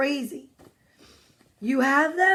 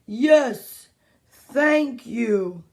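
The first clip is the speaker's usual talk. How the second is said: drawn-out, mild call back; unhurried mock yell; slow character yelling back; much slower than usual. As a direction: stretch each word as a slowpoke would. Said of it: "this is what he's gonna yell back".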